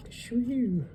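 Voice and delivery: sudden high falsetto whoop; surprised whoop. Real, not acted. Collector spotting bad centering, surprised falsetto whoop. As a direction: yelp in surprise at the flaw. Shock at thick back border.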